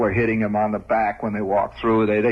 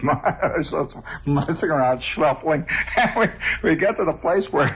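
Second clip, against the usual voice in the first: normal talking voice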